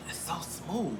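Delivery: soft whisper; hushed admiration. Real, not acted. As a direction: whisper it, marvelling at the feel. Admiring her own skin.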